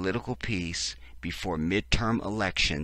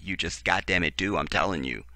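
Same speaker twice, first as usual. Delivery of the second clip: ordinary delivery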